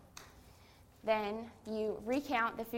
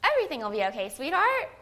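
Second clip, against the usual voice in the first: imitating mom, higher and louder, sweetly soothing; motherly voice; 5 semitones higher, 6 dB louder, faster than usual. Mom reassuring her stressed daughter, sugary motherly tone. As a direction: play the mom who pats your head with her voice. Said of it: "in the most motherly voice possible".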